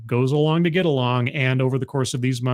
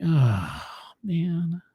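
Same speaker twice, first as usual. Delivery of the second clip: deflated groan; 3 semitones higher than usual. Acted. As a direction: groan it in mock defeat.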